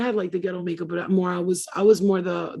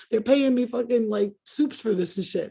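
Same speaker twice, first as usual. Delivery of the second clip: usual speaking voice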